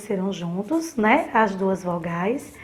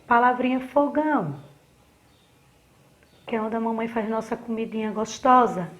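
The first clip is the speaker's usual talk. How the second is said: ordinary talk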